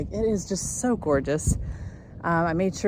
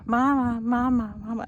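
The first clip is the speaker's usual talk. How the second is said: lightly imitating a baby's happy mama call; mild baby cooing mama; happy baby babble; 5 semitones higher than usual. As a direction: coo it like a pleased baby, a touch higher. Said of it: "It was a".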